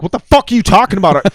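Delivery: loud, high, fast angry yell; mad outburst. Retold or imitated. To the caller who says he was right, angry shout. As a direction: blast it back like you're furious. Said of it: "like, mad".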